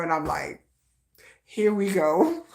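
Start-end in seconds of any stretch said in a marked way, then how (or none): 1.4-2.4 slow, resigned "Here we go."; eye-roll sigh of a line; weary here we go. Retold or imitated. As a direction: drag out "Here we go." like you've heard it all. Reacting to the stalker's line.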